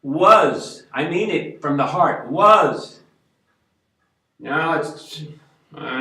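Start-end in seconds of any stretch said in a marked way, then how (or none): 0.0-0.8 Was punched high and loud; stress on WAS. Shown as a demonstration. Telling viewers the word that matters, Was stressed loud. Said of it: "Emphasis on the word was". 2.3-3.0 Was punched high and loud; stress on WAS. Shown as a demonstration. Telling viewers the word that matters, Was stressed loud. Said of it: "Emphasis on the word was".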